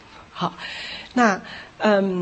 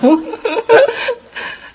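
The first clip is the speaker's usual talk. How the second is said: laughing almost to tears; high helpless laugh; 7 dB louder than usual. Real, not acted. Pastor laughing at his own failed attempt, near tears. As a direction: laugh at your own failure.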